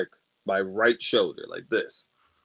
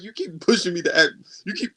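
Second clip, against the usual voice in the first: raised-pitch exasperated complaint; losing his shit; 7 semitones higher, faster than usual. Retold or imitated. Warning his ex she keeps pushing him, exasperated. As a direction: imitate being pushed past your limit. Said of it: "lose my shit in the middle".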